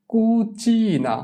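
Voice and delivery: কুচিনা very slow, the middle চি held long and high; pronunciation demo. Shown as a demonstration. Teacher dictates so pupils can fill the blank, কুচিনা slowly stretched on চি. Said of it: "আমি উচ্চারণ বলে দিব".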